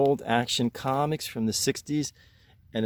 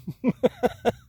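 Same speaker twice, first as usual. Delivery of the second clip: high rueful laugh; 11 semitones higher, 4 dB louder than usual. Real, not acted. Laughs at his own outburst.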